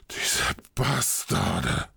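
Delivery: slow, breathy, appalled gasp; horrified gasp. Acted. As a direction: gasp it out in horror. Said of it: "keuchte Torvald entsetzt".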